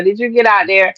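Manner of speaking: normal talking voice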